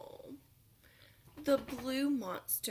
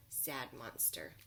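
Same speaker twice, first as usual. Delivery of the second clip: droopy sad; 8 semitones lower, 8 dB quieter than usual. Acted. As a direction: lower and much softer Sad Monster.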